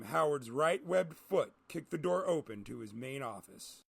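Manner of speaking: ordinary delivery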